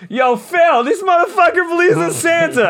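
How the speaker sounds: high, laughing mocking call to a friend; calling out the kid to Phil; jeering bully. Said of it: "He goes".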